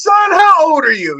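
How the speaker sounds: put-on high, loud, astonished old man; amazed old actor. Scott Wilson astonished at the young fan, loud. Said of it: "he goes".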